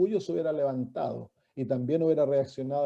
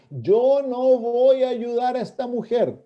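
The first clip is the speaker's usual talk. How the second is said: higher, slow, drawn-out declaration of refusal; emphatic refusal; 7 semitones higher, 4 dB louder than usual. Retold or imitated. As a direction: refuse firmly, stretching the words. Voicing Jesus's refusal emphatically.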